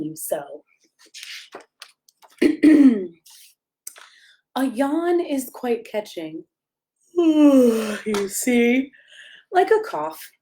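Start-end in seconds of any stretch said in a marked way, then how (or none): 2.4-3.1 a short throat clear before reading. Real, not acted. Parent clearing throat before the bedtime read, a short throat clear. 7.2-8.0 a loud put-on yawning sigh. Reading the yawn book to a child, a loud put-on yawn.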